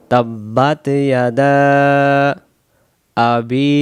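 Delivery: slow, measured verse recitation with held vowels; teacherly chant, pausing between phrases; Quran recitation. Shown as a demonstration. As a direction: recite deliberately, stretching long syllables. Recites sura from the start.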